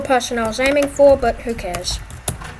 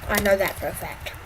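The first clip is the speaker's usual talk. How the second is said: normal talking voice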